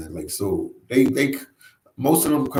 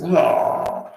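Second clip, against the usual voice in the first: yawn; 9 semitones higher than usual. Shown as a demonstration. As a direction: demo yawn, airy and high. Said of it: "Sometimes I'll be doing this".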